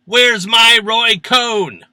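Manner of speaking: high, loud, drawn-out yell; comic yell. Acted. Comedian retelling painful dermatologist visit, hammed-up yell. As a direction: bellow it slowly in mock pain. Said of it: "causing me to yell".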